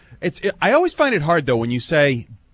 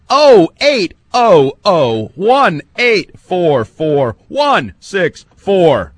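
very slow, punched-out phone number; slow, emphatic digits; 2 semitones higher, 7 dB louder, much slower than usual. Real, not acted. Host giving German callers the number, slow and emphatic. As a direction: hammer out each digit, long and separate.

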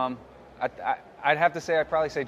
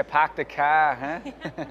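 slow, louder Boston accent with the r's dropped, "pahk the cah"; 3 semitones higher, 3 dB louder, much slower than usual. Shown as a demonstration. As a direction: stretch out "park the car" in a thick Boston accent, no r's. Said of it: "Can you do a Boston accent for us?".

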